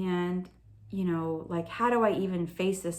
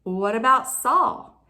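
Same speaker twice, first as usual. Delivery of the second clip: raised, pressing challenge; challenging God; 5 semitones higher, 5 dB louder, slower than usual. Retold or imitated. Believer argues with God about Saul, challenging. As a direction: argue the case louder, higher and indignant.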